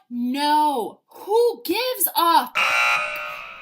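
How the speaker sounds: dismissive. Real, not acted. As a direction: drag it out, flicking it away. Dismissive outburst.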